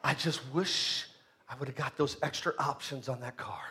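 a hint of a weak voice at the end of life; dying person's wish. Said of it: "somebody on a deathbed saying".

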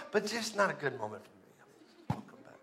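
sudden low, soft backing-down; mock reluctant. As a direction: deflate into a quiet, sheepish excuse. Imagined David backing out of the fight.

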